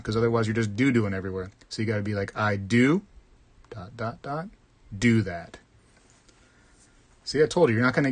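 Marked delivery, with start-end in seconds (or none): 2.4-5.6 "I do, dot, dot, dot, do that" drawn out with long pauses between each word; slow it right down, word by word, so "do do" doesn't blur; said slowly. Shown as a demonstration. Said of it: "you gotta slow down".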